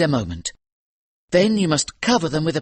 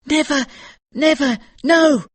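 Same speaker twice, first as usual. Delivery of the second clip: put-on much higher, loud frightened child; frantic boy's shout, higher and louder; boy crying out; 5 dB louder than usual. A narrator's reading. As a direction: cry out desperate denials. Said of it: "cried the boy".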